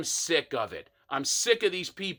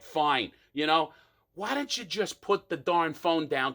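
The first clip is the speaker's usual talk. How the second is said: normal talking voice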